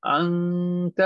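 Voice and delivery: drawn-out nasal hum in अंत before the final ta; nasal ikhfa. Shown as a demonstration. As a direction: hold the noon of अंत long, hidden in the nose. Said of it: "इख्फा छुपा रहे हैं".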